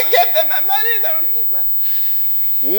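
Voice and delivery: high, hammed-up crying demand, trailing off; mock tearful high yell for money; mock screaming customer. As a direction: wail for the money like a spoiled child. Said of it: "crying there and screaming".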